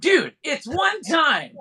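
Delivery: high, slow whine of protest; whiny protest. Real, not acted. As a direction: drag it out, whining high. Defending his bad connection.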